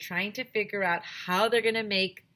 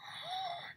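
sharp gasp; 11 dB quieter than usual. Acted. Gasp heard after the idea.

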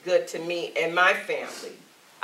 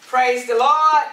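louder call; 5 semitones higher, 7 dB louder than usual. Real, not acted. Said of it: "Let's try this again".

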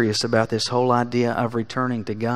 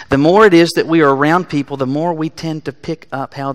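preaching at full force; 3 semitones higher, 7 dB louder than usual. Acted. Preaching burst inside sermon.

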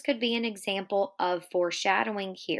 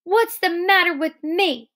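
high, loud indignant outburst; acted angry protest; angry cry; 11 semitones higher, 8 dB louder than usual. A narrator's reading. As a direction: cry out, much higher and louder. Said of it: "she cried".

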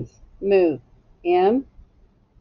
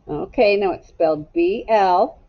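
normal talking voice

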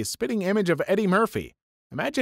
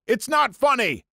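higher-pitched drill instructor outburst; mock stern reprimand; 7 semitones higher than usual. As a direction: lightly act out an instructor scolding the squad. Drill instructor reprimands squad, retold.

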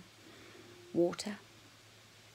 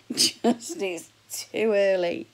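drawn-out groan; 8 dB louder, much slower than usual. Retold or imitated. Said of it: "I went".

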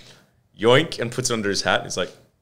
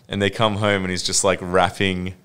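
ordinary talk

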